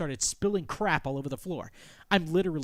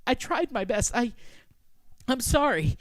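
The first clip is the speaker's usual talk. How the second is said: high, raised pleading voice; faltering apology; 7 semitones higher, 4 dB louder than usual. Acted. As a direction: stammer out an apology, halting. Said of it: "I try to explain myself".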